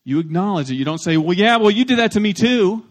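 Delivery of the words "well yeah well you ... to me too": mock defensive comeback, high and loud; defensive retort. Acting a spouse answering a complaint, loud and defensive. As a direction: throw the blame back, raised and indignant.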